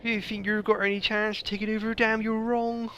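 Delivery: very high, hammed-up regional English accent; put-on native accent. Gamer answers the Legate back in a high, hammed-up native accent. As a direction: challenge him in a high mock native accent. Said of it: "put on my proper native, accent".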